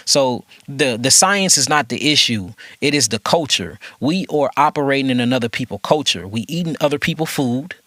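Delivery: normal talking voice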